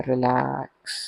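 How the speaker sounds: soft, lingering relax that fades out; soothing relax cue. Real, not acted. Yoga teacher relaxing listeners' feet, soft drawn-out cue. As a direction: stretch relax gently into silence.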